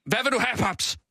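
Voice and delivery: pushy question on a coach's order; hard demand. Shown as a demonstration. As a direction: put it hard to Paprika, pitch jumping up. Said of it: "Hårdt".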